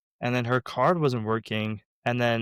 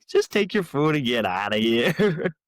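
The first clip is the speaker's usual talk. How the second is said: slow, loud mock-exasperated dismissal; hammed-up get-lost; mock shooing; 3 semitones higher, 5 dB louder, slower than usual. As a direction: draw it out loudly, playfully sending them off. Acts out shooing the customer away.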